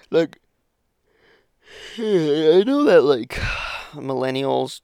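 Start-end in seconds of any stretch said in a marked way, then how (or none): 2.0-3.0 long tired sigh, then slow words; weary sigh. Real, not acted. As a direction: sigh it out before speaking. Exasperated sigh before a rant.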